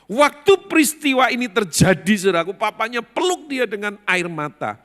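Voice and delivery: loud, high preaching climax; impassioned preaching. Real, not acted. Preacher telling of the father's tearful embrace, impassioned. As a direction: proclaim it at full force.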